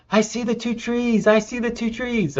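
imitating a kid's thrilled high cry, pitched well up; mock yell of a boy seeing the trees for the first time, high and excited; retold screaming. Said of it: "And he starts screaming".

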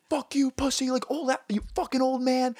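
high, strained reenacted yell at an old cyclist; high mock road rage shout; retold shouting match. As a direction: yell the insults back, reliving the road rage. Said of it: "it was a fucking screaming match".

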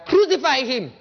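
loud quoted outcry; crowd's cry. Acted. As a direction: call it out like the crowd. Acting the crowd demanding execution.

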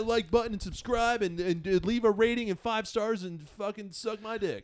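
high, loud hyped-up YouTuber sign-off; corny YouTuber outro. Retold or imitated. To listeners, mocking corny outros, in a loud hyped YouTuber voice. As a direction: ham up a cheesy YouTube outro. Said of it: "I know I could be like".

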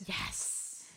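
soft hissed yes; whispered triumph. Real, not acted. Contestant pleased at a correct answer, whispered triumph. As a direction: celebrate under your breath.

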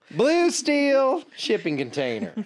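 high, slow, posed Blue Steel delivery; dramatic model voice. Pretend. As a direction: strike a pose with the voice. Joking about shipping container.

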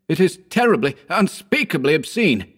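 high, emphatic disgust; exclaiming. A narrator's reading. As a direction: cry out in revulsion. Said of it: "I exclaimed".

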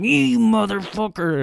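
high yelled curse at another driver; replayed road-rage yell; retold scream. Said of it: "I was just screaming".

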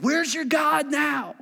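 high, slow jeering question; mock the martyr; taunt. Persecutors taunting a martyr, high and slow. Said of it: "the taunts of".

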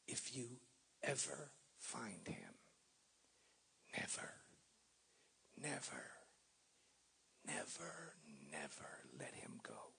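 mild whispered plea, trailing off on each never; lightly voiced old man pleading in a whisper; hushed old man's plea. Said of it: "he said in a broken brogue".

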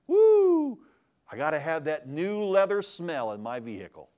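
high, loud whoop then giddy car lover; mocking materialist. Preacher mocking a man who worships his car. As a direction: gush over the new car smell.

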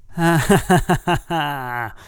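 theatrical villain's cackle, starting high; long gloating stage laugh; villainous laugh. A narrator's reading. Gwawl gloats in triumph with a villainous laugh. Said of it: "utters a villainous laugh".

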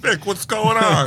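put-on old grandpa voice. Voicing Grandpa Vic arriving.

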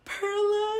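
high, loud mock wail; imitated crying. Caller phones best friend in tears, mock crying.

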